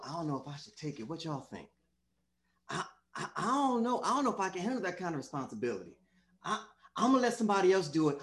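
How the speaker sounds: quick, stammering self-doubt; acted dithering. Preacher playing a doubter offered a job, quick hesitant dithering. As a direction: waver and stumble, rushing the excuses.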